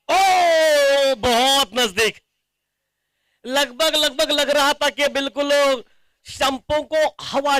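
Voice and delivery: loud, drawn-out gasp at a near miss; excited near miss. Real, not acted. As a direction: rise up at the close call. Near miss past the stumps.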